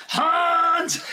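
high, drawn-out mock shout; imitated scream. Detective in Lupin the Third screams, imitated scream. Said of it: "the detective screams".

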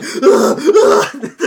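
high, loud exasperated groan; frazzled GM groaning and laughing; high pained laugh-groan. Retold or imitated. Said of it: "Alex is like".

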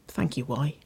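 softly spoken, near-whispered thanks. A narrator's reading. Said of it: "she whispered".

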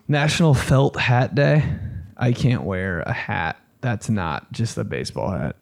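ordinary talk

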